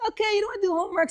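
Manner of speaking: high, fast, breezy pushover parent; lenient parent. Retold or imitated. Parent letting a child skip homework, high and breezy. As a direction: toss it off like an easygoing parent. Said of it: "You can't always say".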